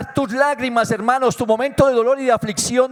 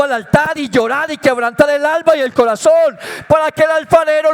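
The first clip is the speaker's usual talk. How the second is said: rising louder and higher to a shouted climax; preaching building to a shout; 3 semitones higher, 4 dB louder than usual. Acted. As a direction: build from testimony into a full-voiced cry. (predicación que crece hasta gritar)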